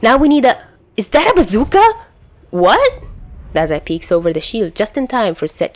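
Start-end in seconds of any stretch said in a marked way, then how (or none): 0.0-1.8 higher, louder sudden alarm. A narrator's reading. Chuya spots a bazooka mid-sentence, loud high alarm. 2.5-2.9 a high loud startled "what". A narrator's reading. Dazai reacts to the bazooka, high loud startled.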